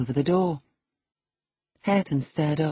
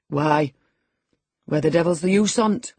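louder gruff Yorkshire growl; growled retort; 5 dB louder than usual. A narrator's reading. Hareton to Linton, louder gruff growl. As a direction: snarl it back. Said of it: "growled Hareton".

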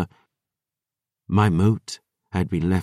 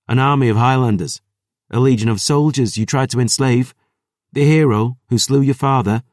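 louder and higher, fast and defiant; defiant taunt; 5 semitones higher, 5 dB louder, faster than usual. Acted. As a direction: hurl it back at her, fast and triumphant. Rain defiantly taunts the vampire.

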